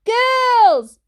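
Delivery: very high, loud shout. A narrator's reading. Mum yelling the word down to Dad. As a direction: yell the one word down the stairs. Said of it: "shouted Mum".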